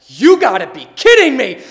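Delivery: loud, high outburst of retold fury; instant anger. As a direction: erupt in exasperated disbelief. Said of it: "I got so angry so fast".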